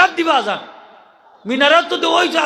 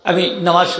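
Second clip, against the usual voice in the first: ordinary talk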